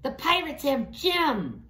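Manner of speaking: little rat crying out to the captain; Rizzo's squeaky voice. Acted. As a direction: squeak it out in alarm. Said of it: "said Rizzo".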